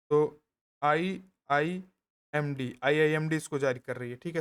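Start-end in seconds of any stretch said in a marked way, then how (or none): 0.8-2.8 letters drawn out one by one; slow dictation. Shown as a demonstration. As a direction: spell out IIMD slowly as you write. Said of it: "short में लिख दे रहा है". (आई-आई-एम-डी अक्षर-अक्षर धीरे खींचकर)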